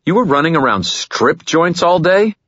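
demanding. Acted. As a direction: demand it in disbelief. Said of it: "I demanded".

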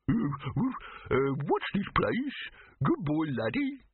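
high, slow, dopey whine; a lost, simple-minded hound; dim dog voice. Acted. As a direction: vacant dog voice rising high. Dim wonder dog again.

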